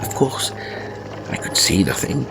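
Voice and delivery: plain reading voice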